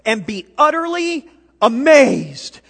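loud, slow, high proclamation; proclaiming loudly. Acted. Preacher reading God's answer to the congregation, loud and slow. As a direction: boom it out as God's word.

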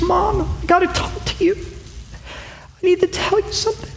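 put-on high, soft small-boy voice; a nervous kid working up to confess; high and hushed, like a child about to cry; anxious little boy.

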